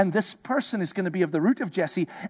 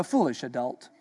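dismissive scorn; 6 semitones lower than usual. Pretend. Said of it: "we would go".

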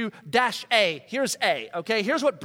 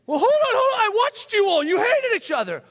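mock outsider; 12 semitones higher, 7 dB louder than usual. Outsider confronting the family.

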